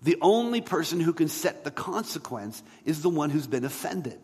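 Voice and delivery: ordinary talk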